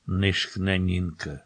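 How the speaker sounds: usual speaking voice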